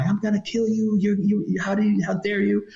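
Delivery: high, mild mock scream; mock furious woman. Woman screaming at the smuggler, mild mock fury. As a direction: rage at the betrayal. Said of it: "she's screaming at the Peruvian guy".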